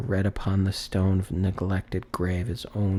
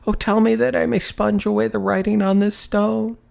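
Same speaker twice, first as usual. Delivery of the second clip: much higher, louder anguished promise; agonized vow; 11 semitones higher, 5 dB louder than usual. Acted. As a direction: vow with everything he has left. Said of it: "In his agony".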